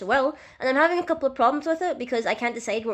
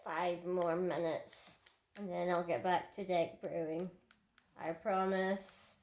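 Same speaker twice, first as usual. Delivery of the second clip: much softer, slow, drawn-out mumble; sheepish quiet promise; 4 semitones lower, 12 dB quieter, slower than usual. Acted. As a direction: trail off guiltily while sneaking off to play. Promises short gaming break.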